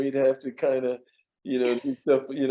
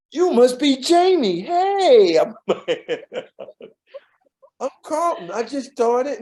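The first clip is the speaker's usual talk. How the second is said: eager newcomer; 9 semitones higher, 5 dB louder, slower than usual. Retold or imitated. Eager newcomer greets tough coworker.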